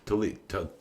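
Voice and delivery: ordinary talk, nothing marked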